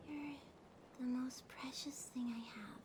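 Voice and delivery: softly whispered confession. Acted. Catgirl confessing to her dead partner, whispered. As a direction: breathe it out like a secret.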